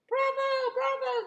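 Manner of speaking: high, loud cheering bravo; shouted bravo from the crowd. Acted. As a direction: cheer like an excited audience. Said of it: "they cheered".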